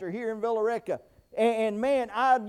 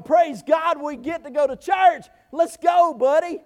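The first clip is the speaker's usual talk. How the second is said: louder, excited call; joyful wake-up; 4 semitones higher, 5 dB louder than usual. Retold or imitated. Dad happily rousing his son for church, excited. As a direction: cheer the kids out of bed with joy. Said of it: "I ought to be running in there going".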